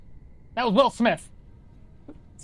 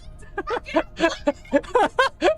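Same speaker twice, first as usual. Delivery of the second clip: giggling laugh; 11 semitones higher than usual. Real, not acted. Laughing at own confusion.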